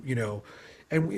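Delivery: ordinary talk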